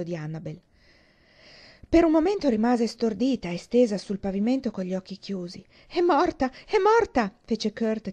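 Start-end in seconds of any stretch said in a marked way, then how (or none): 5.9-7.3 high, loud panic, climbing on the second cry; panicked cry. A narrator's reading. Kurt sees Dee on the floor, high and panicked. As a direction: cry out in terror that she is dead. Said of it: "tirando su col naso e cominciando a piangere".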